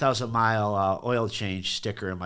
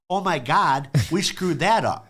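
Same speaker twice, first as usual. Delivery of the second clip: louder, emphatic owning of the mistake; loud admission; 6 semitones higher, 6 dB louder than usual. Retold or imitated. Mechanic telling the angry customer, loud emphatic confession. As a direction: announce it like big news. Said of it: "I said".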